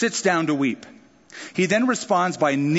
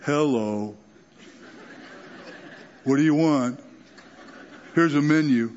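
grumpy waiter. Acted. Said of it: "you go".

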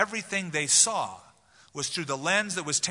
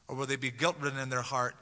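ordinary talk

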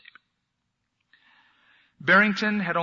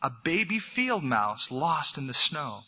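ordinary talk, nothing marked

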